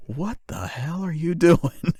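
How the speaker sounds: put-on slow, high, puzzled kid voice; a little girl staring in disbelief; baffled little girl. Imitating a little girl's baffled stare, slow and high. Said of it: "looking at it like".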